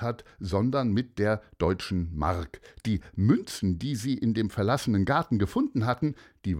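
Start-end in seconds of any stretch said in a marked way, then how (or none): none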